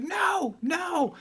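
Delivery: very high shouted no; mock shout. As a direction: yell over the helicopter noise. Yelling over the helicopter.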